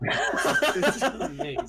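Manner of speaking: high laughing mock awe. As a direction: echo it through a laugh. Friend mocks with a laugh.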